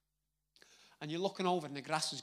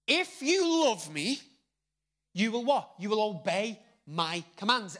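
proclaiming Jesus' words; 5 semitones higher, 9 dB louder than usual. Retold or imitated. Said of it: "Jesus says".

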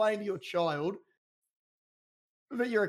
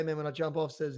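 ordinary delivery

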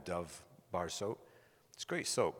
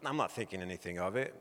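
ordinary delivery

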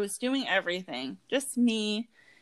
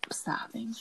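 soft whispered sobbing; whispered through tears; 10 dB quieter than usual. Real, not acted. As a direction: breathe the word out in a whisper. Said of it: "I'm over here".

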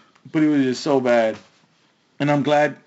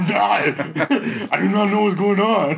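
lightly voiced clumsy Arnold accent; a bad, laughing Schwarzenegger imitation; horrible Arnold; 4 semitones higher, slower than usual. Mocking how his kids would talk, a bad Arnold voice. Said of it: "That was a horrible Arnold that I just did".

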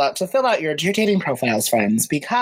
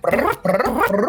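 phone buzz imitation; 6 semitones higher than usual. Phone buzzing with Grindr messages.

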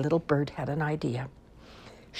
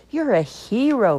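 higher, loud and slow praise; proud; 8 semitones higher, 6 dB louder than usual. A narrator's reading. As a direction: proclaim it with a father's pride. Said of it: "Dragon said proudly".

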